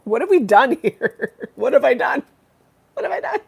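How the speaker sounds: high squeaky laughing what have I done; mock dramatic lament. Wrapping up a long stream, squeaky mock lament. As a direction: ham up a cartoon panic.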